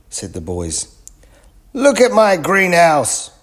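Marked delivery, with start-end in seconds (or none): 1.7-3.3 much higher, louder, slow angry yell; man yelling over his broken greenhouse. A narrator's reading. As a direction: bellow it at the boys. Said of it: "yelled the man".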